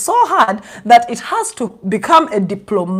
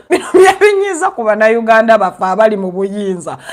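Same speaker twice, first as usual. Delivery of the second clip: normal talking voice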